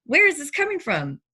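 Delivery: outraged disbelief at being asked about drugs; indignant disbelief. Retold or imitated. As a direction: push back, stunned and offended. Indignant retort to drug accusation.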